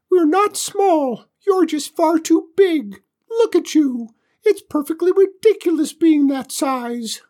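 very high, loud squeaky alien; tiny alien voice. Acted.

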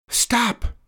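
high, gasped cry; gasping. A narrator's reading. Mister Bond as ice chips sting their cheeks, gasping. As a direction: gasp it out in alarm. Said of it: "gasped Mister Bond".

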